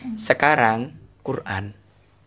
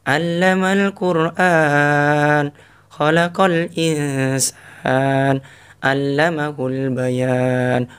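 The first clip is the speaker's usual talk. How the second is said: gentle Quran recitation; much slower than usual. Shown as a demonstration. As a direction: let each word flow tenderly. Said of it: "Kalau Ar-Rahman lembut kayaknya". (bacaan lembut dan pelan)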